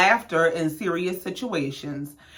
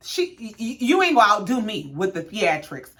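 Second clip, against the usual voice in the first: ordinary talk, nothing marked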